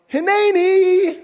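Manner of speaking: very high, slow, loud answering shout; joyful yell back. Acted. Adam and Eve answering God's call, high joyful shout.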